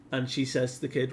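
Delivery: ordinary delivery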